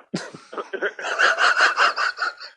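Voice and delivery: loud, high, breathless laughter; real laughing fit. Real, not acted. Cracking up with friend over Corona joke, loud real laughter. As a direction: crack up loudly and keep going.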